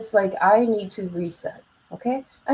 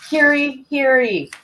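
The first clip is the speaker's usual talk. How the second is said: raised court crier call, louder; grand rich voice; 5 semitones higher, 4 dB louder, slower than usual. Pretend. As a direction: proclaim it like a herald.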